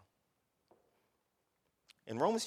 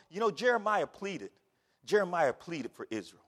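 ordinary talk

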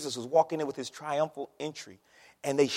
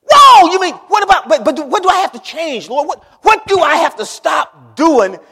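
shouted, much higher and louder, half-panicked; startled shouting questions; 11 semitones higher, 16 dB louder than usual. Acted. Believer asks the Lord what he must change, startled shout. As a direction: blurt it out like a believer caught off guard.

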